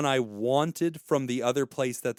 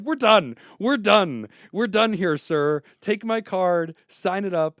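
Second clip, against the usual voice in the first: loud, higher, fed-up declaration; retold loud dismissal; 5 semitones higher, 5 dB louder, slower than usual. Telling the new dealer he is done, loud and fed up. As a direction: slam the door on the deal.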